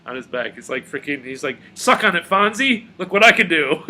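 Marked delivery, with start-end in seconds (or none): 1.7-3.8 mock-cocky taunt, higher and louder; a hammed-up boast. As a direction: show off like a smug hero. Voicing Doctor Strange taunting.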